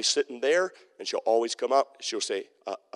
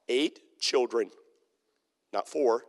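ordinary delivery

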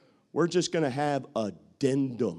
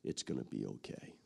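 much lower and softer, gently reassuring; low consoling men; 11 semitones lower, 10 dB quieter than usual. Retold or imitated. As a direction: soothe him like a worried friend. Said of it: "And they're saying".

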